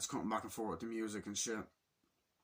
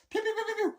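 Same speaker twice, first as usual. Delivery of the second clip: very high, loud pew-pew shots; rapid high-pitched shooting sounds; imitated gunfire; 6 dB louder, faster than usual. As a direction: squeal out cartoon laser-gun noises.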